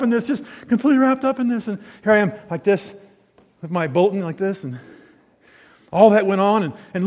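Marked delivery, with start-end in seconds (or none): none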